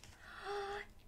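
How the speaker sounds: sharp gasp of surprise; breathless gasp; delighted gasp. Acted. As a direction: gasp in wonder at the gift. Girl opens the box.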